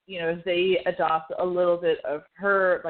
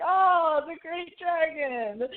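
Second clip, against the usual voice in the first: mock grand Mushu; 9 semitones higher, slower than usual. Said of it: "and he's like".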